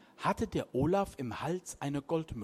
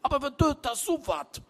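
horrified cry; 7 semitones higher, 3 dB louder than usual. A narrator's reading. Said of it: "rief er entsetzt".

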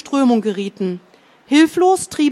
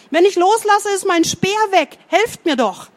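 desperate shout; 8 semitones higher than usual. A narrator's reading. Boy clinging to his spear, pleading shout. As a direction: beg for help at the top of his voice. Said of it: "schrie stehender Bär".

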